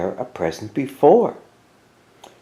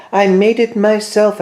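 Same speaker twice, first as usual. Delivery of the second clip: high, louder eager Mouse; 9 semitones higher, 4 dB louder than usual. A narrator's reading. Mouse showing off the cake, high and eager. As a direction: proudly chirp it up.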